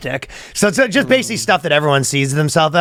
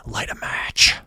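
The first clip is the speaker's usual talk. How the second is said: creepy whisper; 6 dB quieter, slower than usual. Acted.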